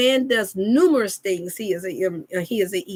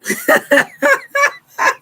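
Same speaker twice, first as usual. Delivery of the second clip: father's knowing laugh; 7 dB louder than usual. Pretend. Father reacting to daughter's trick.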